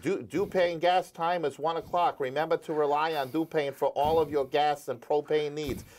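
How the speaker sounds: mock Rhode Island accent of a fifteen-year-old on air; bad Rhode Island accent. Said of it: "with a very bad Rhode Island accent".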